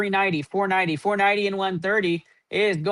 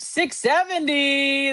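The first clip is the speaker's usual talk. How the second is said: drawn-out hype call; 5 semitones higher, much slower than usual. Real, not acted. Running total hits big number.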